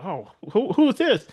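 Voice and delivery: mock surprise.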